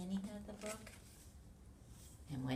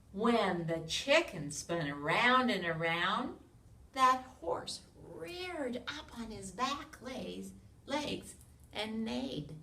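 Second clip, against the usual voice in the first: loud, lively storybook read-aloud; animated picture-book narration; reading to children; 6 semitones higher, 5 dB louder, slower than usual. A narrator's reading. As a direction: read it big for a room of little kids.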